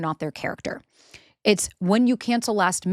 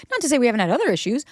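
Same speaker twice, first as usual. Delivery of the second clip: ordinary delivery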